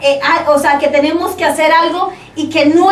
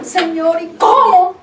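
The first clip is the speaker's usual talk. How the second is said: mock-tearful plea, pitched up; retold tearful question; 4 semitones higher, slower than usual. Woman retells questioning God, in mild mock tears. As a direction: ask God as if crying, voice lifting.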